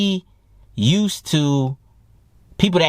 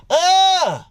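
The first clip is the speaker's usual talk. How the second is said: sudden high-pitched "ugh"; imitated rejection noise. Guy imagining getting shot down, high mock "ugh". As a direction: cut the daydream off with a high mock grunt.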